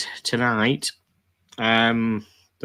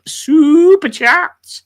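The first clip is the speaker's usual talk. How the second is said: super chat stretched out high and slow, like a jingle; hammed-up call-out; 10 dB louder, much slower than usual. Host hypes a viewer's super chat, stretched high and slow. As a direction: sing it out like a game-show bell.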